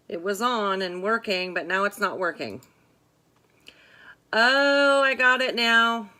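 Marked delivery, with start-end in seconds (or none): 4.3-6.1 louder, relieved burst; suddenly relieved, got it; relieved excitement. Real, not acted. Audio finally fixed.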